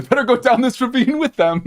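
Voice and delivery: very high, loud absurd resolve; mock shepherd. Shepherd following his sheep down the ravine, loud comic parody. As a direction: squeeze it high, close to laughing.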